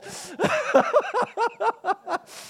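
a high burst of laughter; real laughter at the memory. Real, not acted. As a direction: let the laugh fly up high. Laughter after story beat.

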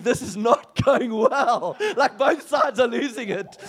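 slow, laughing through the words; can't hold back the laugh; telling the story, laughing through it. Real, not acted. Chaos of the meeting.

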